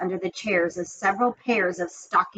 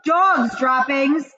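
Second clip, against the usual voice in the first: slow, raised mock cry; imagined yell; 5 semitones higher, 3 dB louder, much slower than usual. Witches sniffing out the hidden boy, mild mock yell. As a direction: stretch it out like witches sniffing out a child. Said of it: "the yell of".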